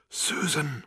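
high, soft wailing cry; hoarse distant wail. A narrator's reading. Ghost crying into a car phone, hoarse distant wail. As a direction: wail the name as if from far off. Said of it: "his voice a hoarse and distant wail".

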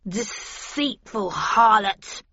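slow, drawn-out insult in character; Hindley's outraged denunciation; angry yell. A narrator's reading. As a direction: spit out the accusation slowly. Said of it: "yelled Hindley".

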